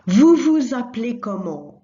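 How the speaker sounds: "Vous vous appelez comment?" said loud, the weight on "vous"; loud model question. Shown as a demonstration. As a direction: call the formal question out clearly. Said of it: "as vous".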